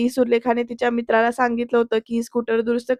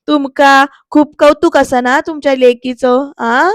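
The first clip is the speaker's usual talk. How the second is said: louder, slow jeering question; mocking taunt; 6 dB louder, slower than usual. Surya taunts the aunt about her niece, mocking and loud. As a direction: needle the aunt with a sneer. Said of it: "असं विचारतो बरं का तो आणि मग पुढे हसायलाच लागतो".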